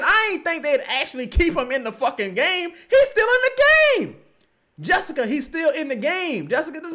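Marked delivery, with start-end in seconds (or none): 2.9-4.2 disbelieving yell. Real, not acted. Disbelief at the substitution.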